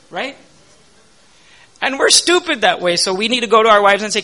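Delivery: ordinary talk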